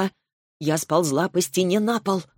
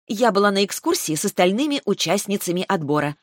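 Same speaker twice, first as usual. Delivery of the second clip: plain reading voice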